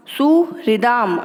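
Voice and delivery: सुहृदाम slowly, with an audible "ri"; pronunciation demo. Shown as a demonstration. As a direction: stretch सुहृदाम, sounding the हृ as "hri". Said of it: "री का उच्चारण भी होना चाहिए". (सुहृदाम धीरे, री स्पष्ट बोलते हुए)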